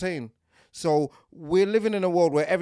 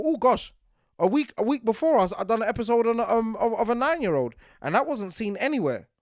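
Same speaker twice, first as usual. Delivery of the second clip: high, raised frustrated inner voice; exasperated; 6 semitones higher, 5 dB louder than usual. Retold or imitated. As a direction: vent the irritation. Retelling own annoyed thought.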